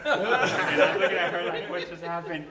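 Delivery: laughing while retelling the moment. Retold or imitated.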